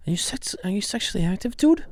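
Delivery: high, quick doctor impression; Mark Wahlberg. Host echoing the clip's doctor questioning a patient, high impression. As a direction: mimic the actor's high voice, ending on dude. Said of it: "just the way he says "dude".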